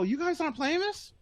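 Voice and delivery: soft, high incredulous question; quiet retold surprise; retold hushed disbelief. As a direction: ask it small and high, rising at the end. Question repeated, now hushed.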